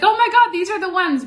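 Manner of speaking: thrilled, high-pitched friend's cry; friend's squeal. Retold or imitated. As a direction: squeal it like the friend who found the perfect flowers. Friend's delighted reaction retold.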